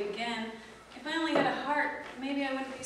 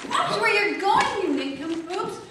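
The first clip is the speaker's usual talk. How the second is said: angry scolding; 5 semitones higher, 7 dB louder than usual. Acted. Tin Man scolds clumsy strangers.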